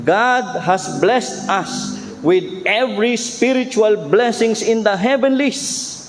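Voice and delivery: slow, solemn reading of scripture. Shown as a demonstration. Preacher quoting Ephesians to the congregation, slow solemn reading. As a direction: read the verse with weight. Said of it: "Ephesians one verse three".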